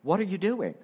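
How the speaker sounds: a hint of an exasperated youth pastor; youth pastor catchphrase. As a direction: toss off his stock scolding question. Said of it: "one of my phrases was constantly".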